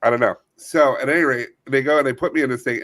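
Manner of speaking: ordinary delivery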